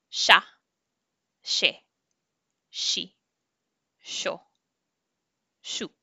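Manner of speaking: "sha, she, shi, sho, shu" with a clear sh; sh syllables. Shown as a demonstration. Teacher leads students through sh syllables. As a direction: hiss the sh on each syllable. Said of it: "let's just practice with the sh".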